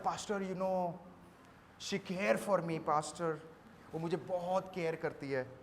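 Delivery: soft, low pleading lover; a mild imitation of a lovesick youth; pleading young man. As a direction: beg the pastor softly. Young man pleads about girlfriend.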